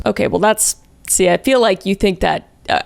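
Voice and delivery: ordinary talk, nothing marked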